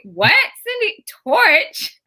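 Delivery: high, laughing disbelief; mock the roommate's confusion; imitating a puzzled friend, up high; baffled roommate. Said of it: "she's like".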